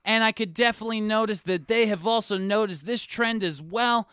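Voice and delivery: news reporter voice. Acted. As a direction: report live from the grocery store. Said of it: "That's not how I talk".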